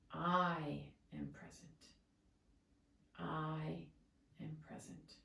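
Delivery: calm, slow affirmation leaning on "I"; stress on I. Shown as a demonstration. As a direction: hold "I", let "am present" fall away. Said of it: "emphasizing I".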